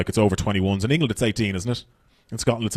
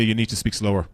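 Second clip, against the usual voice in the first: softer, low mock Russian warning; Russian promoter; 5 semitones lower, 7 dB quieter than usual. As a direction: lean in like a stern Moscow promoter. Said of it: "the guy running the gigs goes to me".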